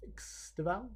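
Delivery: tentative "X Deval?" with a questioning rise; "Deval" as de-VAL, left hanging unsure; struggling pronunciation. Real, not acted. Presenter tries the name another way, hesitant pronunciation. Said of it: "one I'm gonna struggle to pronounce".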